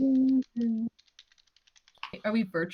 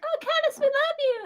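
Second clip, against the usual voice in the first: put-on much higher, sweet kid voices; mock adoring kids. Kids thanking Caddis, high mock adoring voices. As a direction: squeal it like adoring children. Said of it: "They're like".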